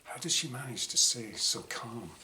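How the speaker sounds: ordinary delivery, nothing marked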